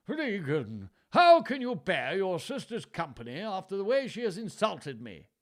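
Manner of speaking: high, loud whining; hammed-up whining plea; whined. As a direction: whine like a wronged old king. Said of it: "Lear whined".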